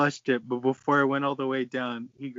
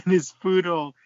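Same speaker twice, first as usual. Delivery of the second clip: repeats the phrase through a burst of laughter; can barely get the words out for giggling; laughing while reading; 5 semitones higher, 6 dB louder than usual. Real, not acted. Reading a crude fanfic to friends, breaking into laughter.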